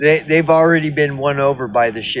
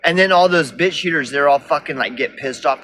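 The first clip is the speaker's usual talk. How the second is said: ordinary talk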